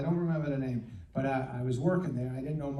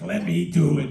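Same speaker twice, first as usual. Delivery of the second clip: impatient coworker; 3 semitones higher, 9 dB louder than usual. Retold or imitated. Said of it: "he'd be like".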